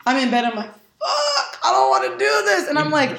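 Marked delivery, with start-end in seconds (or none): 0.7-2.7 whiny groan. Retold or imitated. Dreading the morning session.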